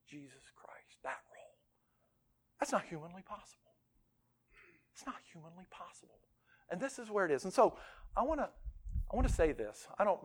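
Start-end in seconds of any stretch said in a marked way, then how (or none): 0.1-6.2 very soft, near whisper; grave hush. Real, not acted. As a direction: drop to a hush on the weighty truth. Gravely delivers the impossible calling.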